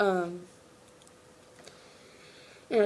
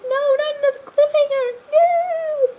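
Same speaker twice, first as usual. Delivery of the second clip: very high, loud wailing protest; over-the-top fan howl at a cliffhanger; mock anguished scream; 8 dB louder than usual. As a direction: wail it in hammed-up despair, drawing out the no.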